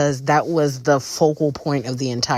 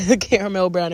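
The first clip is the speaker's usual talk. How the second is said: ordinary delivery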